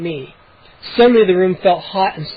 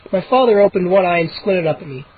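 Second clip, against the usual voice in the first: ordinary delivery, nothing marked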